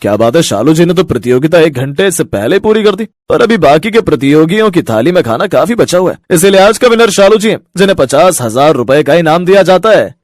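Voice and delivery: winner announcement. Acted. Owner announcing Shalu's win, announcer voice. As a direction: declare the champion to the crowd.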